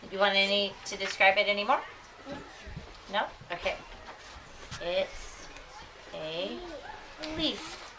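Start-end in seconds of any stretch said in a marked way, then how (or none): 4.8-7.9 soft slow dictation. Shown as a demonstration. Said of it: "teacher dictates while writing".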